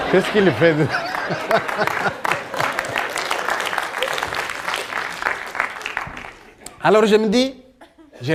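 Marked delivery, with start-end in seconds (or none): 0.9-6.3 a long airy wheezing laugh, pitch rising; breathy laugh. Real, not acted. Comedian to audience, about suspicious neighbours, airy laugh.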